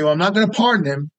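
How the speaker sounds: ordinary talk, nothing marked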